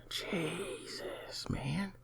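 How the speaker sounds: hushed, high exclamation; whispery, high-pitched amazement; amazed whisper. Real, not acted. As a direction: breathe it out high and very soft, in disbelief. Amazed at the putt-putt competition.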